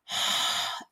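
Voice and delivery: a soft unvoiced haa on the hand; open-mouthed fogging exhale. Shown as a demonstration. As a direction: breathe out as if fogging a mirror. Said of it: "breathe on it with an open mouth".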